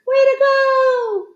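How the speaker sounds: high stretched cheer. A narrator's reading. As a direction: celebrate the winner. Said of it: "Everyone watched and cheered".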